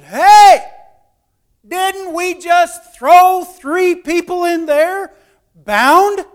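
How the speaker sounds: loud, high, slow disbelieving question; astonished shout. Retold or imitated. Storyteller as startled king asking his men, loud astonished shout. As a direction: shout it out, stunned, stretching the words.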